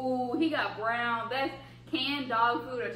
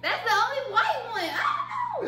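high laughing shout; 11 semitones higher, 5 dB louder than usual. Real, not acted. Unsure which flavour she got.

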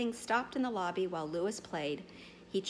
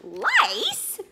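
loud, much higher, outraged roar; 13 semitones higher, 12 dB louder than usual. Acted. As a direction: explode with disbelief. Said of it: "roared the boatman".

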